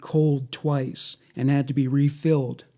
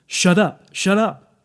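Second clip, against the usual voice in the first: higher, sharp repeated order; angry command; 5 semitones higher, 5 dB louder than usual. A narrator's reading. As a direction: read an impatient silencing command. Reads captor silencing screaming child.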